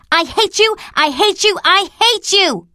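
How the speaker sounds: high, choked shouting, stamping; furious child. A narrator's reading. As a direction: hurl it with each stamp. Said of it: "A louder stamp with each assertion".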